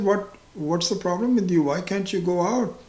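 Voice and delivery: a lightly hammed-up puzzled wife; worried wife. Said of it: "So his wife said".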